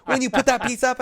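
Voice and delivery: high-pitched mock-insistent teacher; teacher pleading over a shoulder, pitched well up; imitating insistent teacher. Teacher nagging student in retold story.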